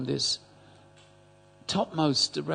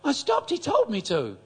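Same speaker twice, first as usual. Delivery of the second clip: put-on old lady voice; 11 semitones higher, 5 dB louder than usual. Old lady tells her story.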